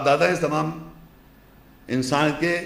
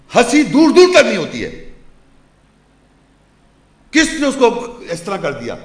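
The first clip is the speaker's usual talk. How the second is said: loud, raised preacher's voice; impassioned preaching; 3 semitones higher, 6 dB louder than usual. Real, not acted. Preacher describing pilgrims entering the sacred mosque, impassioned preaching. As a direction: preach it forcefully, pitch lifted, punching key words.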